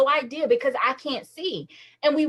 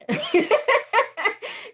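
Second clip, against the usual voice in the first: rising high laugh; 9 semitones higher than usual. Real, not acted. Laughs after mom's words.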